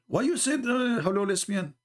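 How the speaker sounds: put-on high indignant girl's voice; upset girl.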